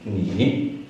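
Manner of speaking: "Ni" dipping, rising at the end; third tone. Shown as a demonstration. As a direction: say "Ni" down and back up. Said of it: "with the third tone".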